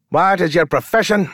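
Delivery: high, sharp demand from a fop. A narrator's reading. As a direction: demand it without looking up. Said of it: "the pretty man demanded".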